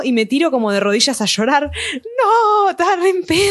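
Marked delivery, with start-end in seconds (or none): 2.2-3.4 mock drunk crying. Retold drunk crying on knees.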